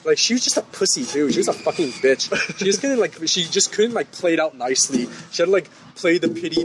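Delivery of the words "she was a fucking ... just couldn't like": normal talking voice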